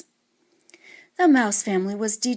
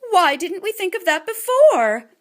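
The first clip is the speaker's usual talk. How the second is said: higher, louder eager mouse mom; excited exclaim; 9 semitones higher, 6 dB louder than usual. A narrator's reading. Mamma Mouse hinting Papa should have thought of it, excited and higher. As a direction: lift it up with eager delight. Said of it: "Mamma exclaimed".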